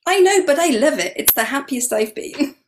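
high, loud happy retort, acted out; gleeful reply. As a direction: blurt it back with glee, starting high and loud. Gleeful reply to teasing friends.

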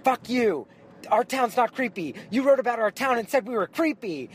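high, loud, furious locals; angry retort. Retold or imitated. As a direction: throw it back at her, outraged. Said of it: "angry butches".

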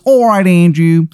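put-on high, hammy Baltimore accent; terrible Baltimore accent. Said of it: "his terrible accent".

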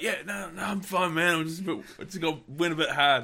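high, winded, brushing it off; breathless young fella after throwing up. Retold or imitated. Exhausted vomiting youngster quoted.

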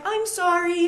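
put-on high, light "I'm sorry" like a willing kid; tossed-off childlike apology, pitched well up; child's easy apology. Imitating a freely apologizing child.

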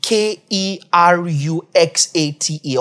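normal talking voice

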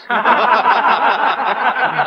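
a short hearty chuckle; amused laugh. Acted. Coach laughing at the new player, amused chuckle. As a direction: laugh at the cocky rookie.

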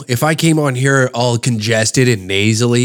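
ordinary talk, nothing marked

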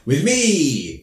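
spring the punchline with glee; gleeful taunt. Retold or imitated. Teacher gloating to the students about detention. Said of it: "And he's like".

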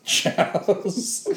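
high, loud laughing "Chows"; laughing mock pronunciation. Shown as a demonstration. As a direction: echo Chows through a laugh. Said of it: "it's pronounced Chows".

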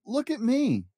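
high pushy show-off cry; Pig yelling. Acted. Pig pushing past Trevor, high bragging yell. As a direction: shove past and demand the spotlight.

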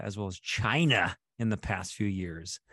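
ordinary talk

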